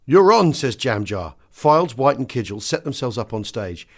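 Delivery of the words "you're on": louder, higher hissed cue; urgent cue. Retold or imitated. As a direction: push the act onstage. Stage manager cueing performer.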